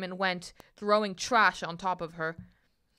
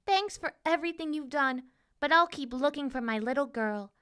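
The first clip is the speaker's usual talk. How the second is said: higher, grateful little princess voice; storybook princess; 6 semitones higher than usual. A narrator's reading. Princess thanks the jay before parting, higher character voice.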